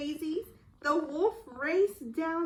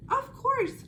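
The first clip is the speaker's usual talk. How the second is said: high, bright eager cry; cried out; 10 semitones higher, 4 dB louder than usual. Acted. Little Red eagerly agreeing to help her mother. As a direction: answer with eager delight. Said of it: "cried Little Red".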